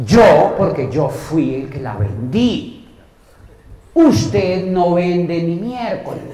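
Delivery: slow, firm, emphatic lecture; stern telling-off. Retold or imitated. Scolding the office worker who never sells, slow and stern. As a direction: lay down the law word by word.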